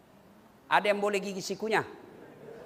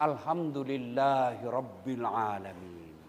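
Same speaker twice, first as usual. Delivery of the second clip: slow, lower reverent praise; devout; 13 semitones lower, 8 dB quieter, slower than usual. Shown as a demonstration. Parent thanking God for a safe child, slow and reverent. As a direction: say it slowly and fully. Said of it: "Jangan kau bilang".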